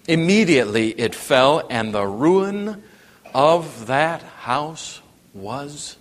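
measured, emphatic Bible reading with long pauses; deliberate pulpit reading, phrase by phrase; scripture reading. Real, not acted. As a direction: read scripture solemnly, leaning on key words. Reads scripture aloud.

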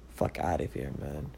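low, quiet dismissal; soft, low aside under the rant; dismissive aside. Real, not acted. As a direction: drop the voice and brush it off. Dismisses the pastor argument in disgust.

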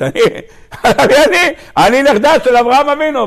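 high mock boast with laughter; mimicked shouty woman. Teacher mocks the woman's boast of lineage, high laughing imitation. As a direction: mimic her outcry, amused and high-pitched. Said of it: "הגברת הזאת צעקנית".

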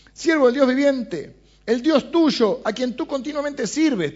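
high, loud anxious call. Acted. King calls into the den, loud high cry. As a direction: cry out to him in the pit. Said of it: "llamó a voces a Daniel".

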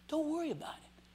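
whispered reassurance. Acted. Soft reassurance to congregation.

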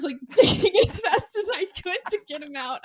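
ordinary talk, nothing marked

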